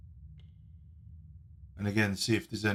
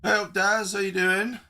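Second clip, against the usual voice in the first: high-pitched voice; 8 semitones higher than usual. Shown as a demonstration. New voice filter try.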